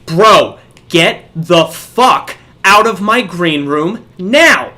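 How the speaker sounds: slow, loud, punched-out command; angry order. Retold or imitated. Fed-up organizer ordering a kid out, angry order. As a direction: hit each word hard, exasperated. Retold confrontation, third order to leave.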